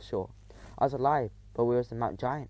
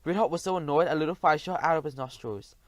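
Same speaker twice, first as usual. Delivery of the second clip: ordinary delivery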